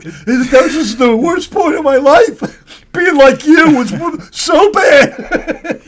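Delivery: laughing mock lament. Friend mocks a contestant's testimonial, loud laughing lament. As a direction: overplay the misery while cracking up.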